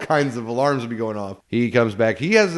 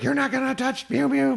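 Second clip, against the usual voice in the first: protective; 13 semitones higher than usual. Retold or imitated. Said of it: "she's like".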